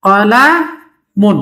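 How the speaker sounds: কলামুন drawn out slowly, syllable by syllable; slow model word. Shown as a demonstration. Teacher to student, slowly modelling কলামুন. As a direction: stretch কলামুন out for the learner. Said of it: "এখানে কলামুন লিখলাম".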